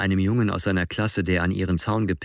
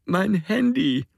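slow, much higher whimper; Alex crying, reaching for his phone; 13 semitones higher, slower than usual. A narrator's reading. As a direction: plead through tears. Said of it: "wimmert Alex".

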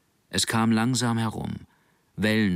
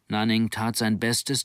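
usual speaking voice

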